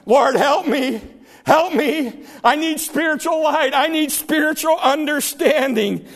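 high, fervent plea to God; crying out. Acted. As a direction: preach it as a sinner's urgent prayer. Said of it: "who cry out to him".

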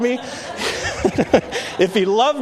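words breaking into a real laugh; laughing through it. Real, not acted. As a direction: laugh at your younger self mid-sentence. Laughing at his childish thought.

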